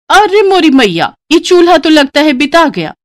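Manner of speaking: high, loud shocked wail; dismayed bride. Acted. Bride finds the gas empty, shocked and loud. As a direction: cry out at the dead stove. Gas runs out mid-cooking.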